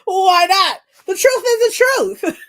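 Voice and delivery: loud, high, laughing defiance; incredulous retort through real laughter; laughing protest. Retold or imitated. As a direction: burst out laughing as you argue back. Laughs retelling protest to manager.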